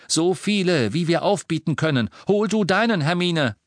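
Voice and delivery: high, loud order; rally your friend; urgent command. Acted. Harry commands.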